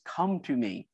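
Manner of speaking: low, soft and calm. Retold or imitated. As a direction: say it gently, as a calm invitation. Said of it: "Jesus just very calmly says".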